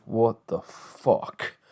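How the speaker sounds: drawn-out slow what the fuck; stunned disbelief. Retold or imitated. Reacting to the exposed scandal, slow mock disbelief. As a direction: let it hang, slow and stunned. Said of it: "I was like".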